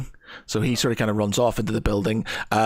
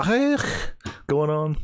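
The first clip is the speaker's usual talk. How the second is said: higher mock-Scouse "hey"; Scouse imitation; 5 semitones higher, much slower than usual. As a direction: mimic a Scouse accent. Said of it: "you definitely sound Scouse".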